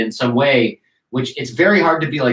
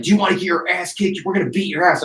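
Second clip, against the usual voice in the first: aggressive threat; 6 semitones higher, faster than usual. Retold or imitated. Militia guys threatening a beating, mildly aggressive. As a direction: pitch it up as a mock threat. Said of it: "They're just being aggressive".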